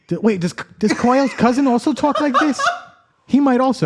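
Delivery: high, put-on nerdy coworker voice. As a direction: talk like the odd guy at the office. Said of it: "he talks like this".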